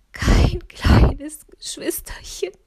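high, slow, whispered fake sobbing; tragic heroine sorrow. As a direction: wail it like a stage tragedy. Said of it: "schluchzte sie". (theatralisch schluchzend)

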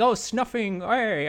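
a silly British snuffing A, slowed and lifted; mock British slang. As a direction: ham up the British game slang. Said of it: "it's like".

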